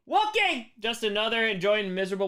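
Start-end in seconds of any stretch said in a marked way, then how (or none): 0.1-0.7 high excited shout of the name; excited call. Real, not acted. Streamer greeting a viewer with a high excited shout.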